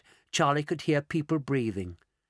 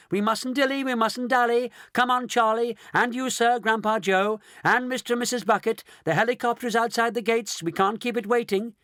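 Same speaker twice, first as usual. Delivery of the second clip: higher, quick-talking host; brisk Wonka; 7 semitones higher than usual. A narrator's reading. Mister Wonka hurrying the family to the helicopter, lightly voiced. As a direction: hurry them on, bright and brisk. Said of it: "he called out".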